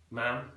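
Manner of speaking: "Ma'am" as Scouse "mam", short A; Liverpool pronunciation. Shown as a demonstration. Teacher demonstrating Scouse word for mum, "Ma'am" as "mam". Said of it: "Instead of mum, they say ma'am".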